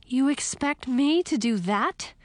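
high, disbelieving question; incredulous. A narrator's reading. Princess to Frog after the dragonfly catch, incredulous. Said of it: "I asked incredulously".

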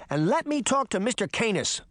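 put-on high-pitched insistent child; defiant little girl. A narrator's reading. Daphne demanding Mister Canis from the wolf, defiant.

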